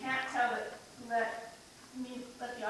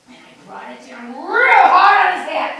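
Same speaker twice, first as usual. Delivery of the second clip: much louder, swelling with the blow; violent outburst; 8 semitones higher, 21 dB louder than usual. Acted. Shopper reliving the blow to his head, explosive shout. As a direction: burst into a loud yell. Acts out striking the man.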